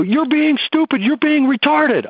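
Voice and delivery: put-on high, whiny teenage voice; mock teen insult. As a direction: play the bratty teen. Acted teen insult in dialogue.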